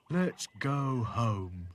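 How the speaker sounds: very slow, loud doll moan. A narrator's reading. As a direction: drag out every word in complaint. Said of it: "he moaned".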